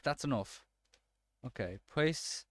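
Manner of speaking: usual speaking voice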